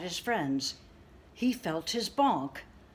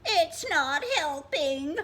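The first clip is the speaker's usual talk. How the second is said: high, slow, loud tearful whine; crying bird; 9 semitones higher, 6 dB louder, slower than usual. Acted. Crying bird telling Rabbit the hug failed. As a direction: whimper it, still hurting. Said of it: "Bird cried some more".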